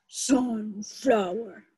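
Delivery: slow, split robot word; drawn-out robot puppet voice. Acted. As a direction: say it like a stiff little robot. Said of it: "he talks a little funny".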